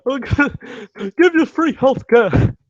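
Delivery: put-on high, loud, strained old-man rant; Bernie Sanders impression. Mocking a candidate to his viewers in a high loud Bernie voice. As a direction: squeeze the voice up high like a raspy old politician. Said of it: "my Bernie Sanders impersonation".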